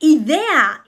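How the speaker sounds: usual speaking voice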